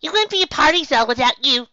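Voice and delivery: high, loud excited pony voice; high character voice. Acted. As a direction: squeal it out like a giddy little pony. Character voice in fanfic reading.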